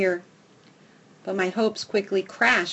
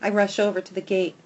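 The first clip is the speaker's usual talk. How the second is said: ordinary delivery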